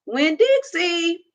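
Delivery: put-on girl calling her runaway dog. A narrator's reading. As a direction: call the dog back from afar. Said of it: "I called".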